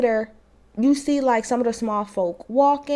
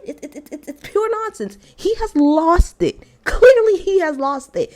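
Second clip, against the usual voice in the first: usual speaking voice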